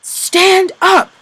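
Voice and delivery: loud high yell; shouted order. Acted. Capo yelling at the fallen prisoner, high and loud. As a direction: yell at him to get up. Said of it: "what he was shouting".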